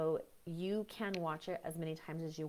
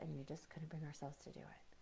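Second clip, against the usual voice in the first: whisper; 2 semitones lower, 12 dB quieter than usual. Real, not acted. Confessing the shame of envy.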